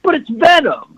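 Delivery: high-pitched fanboy protest; mock angry nerd. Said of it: "anger and the hatred that nerds will spew".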